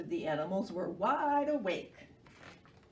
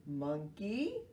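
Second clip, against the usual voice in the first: sleepy sloth voice; 4 semitones lower, 3 dB quieter than usual. Acted. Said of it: "he said".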